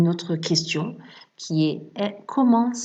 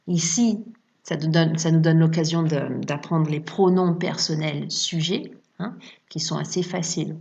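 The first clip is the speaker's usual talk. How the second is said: usual speaking voice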